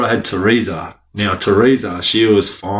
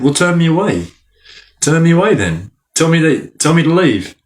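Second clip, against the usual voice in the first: defiant challenge; 6 semitones higher than usual. Retold or imitated. Retold defiance to counsellor Amy.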